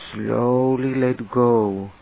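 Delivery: normal talking voice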